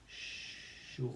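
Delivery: sure whispered as sh sh or, sound by sound. Shown as a demonstration. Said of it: "we can write it sh".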